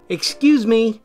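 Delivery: snippy "Excuse me" with a sharp high start; curt excuse me. Shown as a demonstration. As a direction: clip "Excuse me" with attitude. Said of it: "No exaggeration on the attitude".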